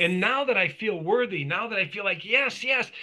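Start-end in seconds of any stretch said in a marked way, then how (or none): 2.2-2.9 high, bright burst of yes; joyful yes. Retold or imitated. Man recalling feeling worthy again, cheering yes. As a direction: cheer it out, pitched way up.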